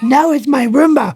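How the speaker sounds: high-pitched mock protest; imitated indignant yell. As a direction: yell it as a possessive comic protest. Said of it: "I like when you were yelling".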